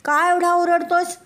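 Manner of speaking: high, loud chirpy chick; little chick voice. Acted. Chick asking the shouting rabbit what happened, high and loud. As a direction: squeak it out like a tiny chick. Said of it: "कोंबडीचं पिल्लू म्हणालं".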